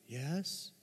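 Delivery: put-on very soft little-kid yes; small child's answer. Toddler meekly answering the adult's question, imitated very softly. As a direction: imitate a subdued toddler, hushed.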